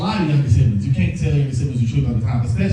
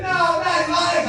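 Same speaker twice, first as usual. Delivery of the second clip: much higher, slow panicked plea; panicked dad; 12 semitones higher, slower than usual. Retold or imitated. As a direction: plead up high like a frantic father. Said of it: "He said".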